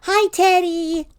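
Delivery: high, loud, drawn-out hello; cheery greeting. A narrator's reading. You call to Teddy coming in, singsong and cheery. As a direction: call out brightly as you arrive home.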